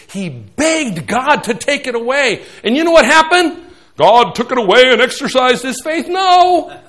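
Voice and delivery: loud, high preaching at full force. Acted. Pastor preaching to the congregation, at full force.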